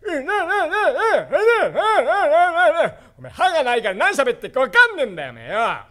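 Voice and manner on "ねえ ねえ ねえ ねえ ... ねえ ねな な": toothless ancient grandpa. Acted.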